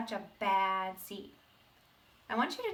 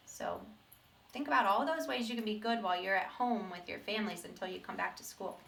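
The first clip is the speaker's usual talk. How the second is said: usual speaking voice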